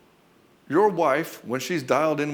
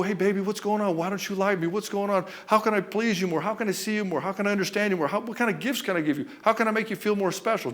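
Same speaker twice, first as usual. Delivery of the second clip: higher, mocking pleading simp voice; mock the clingy husband begging for approval; needy pleading husband; 6 semitones higher than usual. Coach mocking a needy husband begging his wife, higher pleading. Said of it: "They try to double down in presence".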